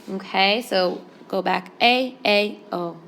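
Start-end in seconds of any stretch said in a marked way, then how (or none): none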